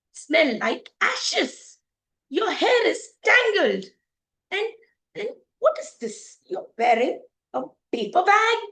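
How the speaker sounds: loud, halting, appalled complaint; disgusted scolding. Acted. Prince criticising the princess's appearance, scornful. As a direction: pick out each fault with emphasis.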